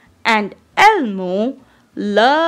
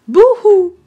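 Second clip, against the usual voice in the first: hammed-up boo-hoo, high and loud; mock crying; 7 semitones higher, 6 dB louder than usual. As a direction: fake a sob for the joke. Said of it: "Please don't cry".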